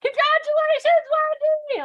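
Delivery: loud, high cheering shout; screaming congratulations. Shown as a demonstration. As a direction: yell it out like a cheering crowd. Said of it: "this is what you're going to scream".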